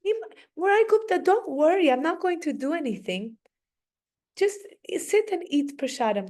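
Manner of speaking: playful, high don't-worry voice; mock reassurance. Boy Nimai reassures his frightened friend.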